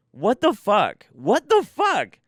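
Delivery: rising high, loud, slowing disbelief; can't believe what you just heard; loud stunned disbelief. Real, not acted. Disbelief at the story.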